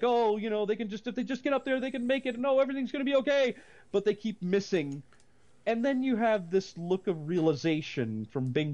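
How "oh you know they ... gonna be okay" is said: mock hopeful. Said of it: "it's like".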